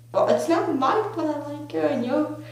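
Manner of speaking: high-pitched, retold self-defence; acted defensive protest. Crush defends himself in retold quarrel.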